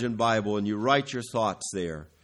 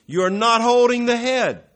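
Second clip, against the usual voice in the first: much higher and louder, a ringing cry; preaching shout; 11 semitones higher, 11 dB louder than usual. Acted. Preacher crying out a warning to the congregation. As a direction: hurl it out as the climax.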